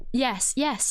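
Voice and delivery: ordinary talk, nothing marked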